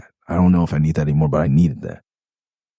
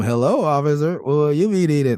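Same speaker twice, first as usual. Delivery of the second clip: higher cartoonish polite-to-cop voice; Far Side voice; 9 semitones higher than usual. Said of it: "that's definitely Far Side voice".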